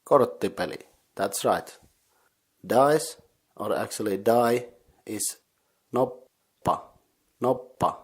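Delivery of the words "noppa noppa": "noppa" as nop, long silent gap, pa; separated double consonant. Shown as a demonstration. Teacher showing the word for die, "noppa" split at the double p. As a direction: stop on the p in "noppa", then release pa. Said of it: "These double consonants are".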